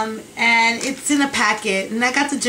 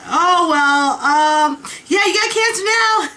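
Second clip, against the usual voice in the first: high, breezy mock doctor voice, much higher; 7 semitones higher, 5 dB louder, slower than usual. Mimicking the doctor casually announcing cancer, high and breezy. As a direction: toss off the bad news like it's nothing.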